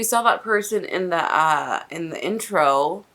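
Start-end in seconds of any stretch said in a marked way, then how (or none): none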